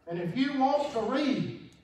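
high, loud, drawn-out preaching; full force preaching. Acted. Preacher telling the congregation the Bible story, in full cry. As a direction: lean into the gospel story.

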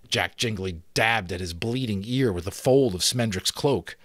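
ordinary delivery, nothing marked

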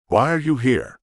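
usual speaking voice

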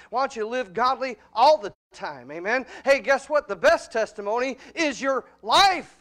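full-force pulpit preaching. Acted. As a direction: preach it hard to the congregation.

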